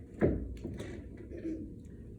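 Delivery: long, fussy throat-clear; showy preparatory ahem. Acted. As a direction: clear your throat before a grand recital. Acted aunt preparing to recite.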